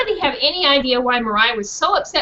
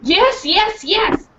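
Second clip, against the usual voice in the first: eager dog; 8 semitones higher, much slower than usual. Acted. Dog barks yes.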